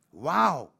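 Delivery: loud, rounded exclamation; big thrilled wow; enthusiastic. A narrator's reading. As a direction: exclaim with delighted awe. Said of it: "rief ich begeistert".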